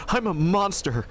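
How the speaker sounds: lightly hammed lament; mock anguish. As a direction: play at grief over killing Solaire. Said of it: "And I'm just crying".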